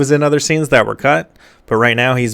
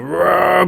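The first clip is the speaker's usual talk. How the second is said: higher-pitched playful growling roar; mock monster roar; 8 semitones higher than usual. Monster is seen roaring, mock roar. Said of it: "Pyramid Head is like".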